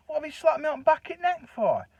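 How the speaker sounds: high, loud put-on outrage; mock accusation. Teasing the husband over a fake slap, mock outrage. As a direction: pretend he slapped you, hammed up. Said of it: "You know, joking like".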